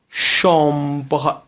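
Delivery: شامبر very slow, first vowel nasal; teacher sounding out شامبر slowly through the nose; nasal French demo. Shown as a demonstration. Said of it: "دقیقاً همین اتفاق میفته".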